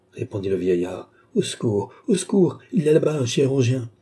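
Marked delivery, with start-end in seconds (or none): 1.3-3.9 climbing to a high, loud call; calling for help. A narrator's reading. Old man summoning a surgeon, calling out. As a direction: call out urgently, higher on the repeat.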